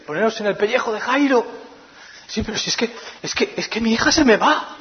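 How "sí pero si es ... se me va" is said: higher, pressing, impatient father; anxious hurrying. Retold or imitated. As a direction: rush him along. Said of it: "me lo imagino metiendo prisa". (padre angustiado metiendo prisa a Jesús)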